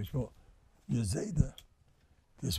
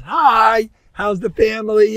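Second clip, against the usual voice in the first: loud, higher friendly greeting called out; cheery hello; 7 semitones higher, 9 dB louder, slower than usual. Retold or imitated. Out-of-towner greeting everybody on the street, loud and cheery. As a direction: call hi out like a neighbor on the street. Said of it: "where everybody says".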